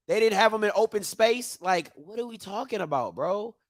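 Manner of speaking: ordinary talk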